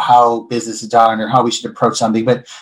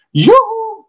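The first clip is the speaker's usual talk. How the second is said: put-on a much higher, sing-song yoo-hoo from the old lady client; the guest sweetly calls the waiter back, up high; calling yoo-hoo. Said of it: "she's like".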